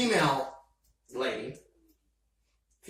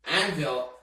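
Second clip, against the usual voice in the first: normal talking voice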